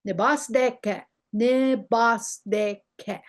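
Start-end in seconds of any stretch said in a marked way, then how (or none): 1.3-3.2 "ne bas de ke" drawn out slowly, each syllable apart. Shown as a demonstration. Teacher modelling "ne bas de ke" for learners, slow and separated.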